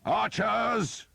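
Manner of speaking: high, loud call to the archers; shouted command. A narrator's reading. The Earl calling his archers, a loud high shout. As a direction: bellow for the bowmen. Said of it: "the Earl shouted".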